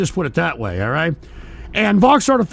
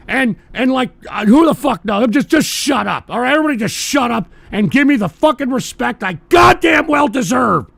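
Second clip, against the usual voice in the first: loud, higher furious demand for respect; angry rant; 6 semitones higher, 4 dB louder than usual. Real, not acted. Streamer yelling at his whole audience for respect, angry rant. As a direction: rant at them till they shut up.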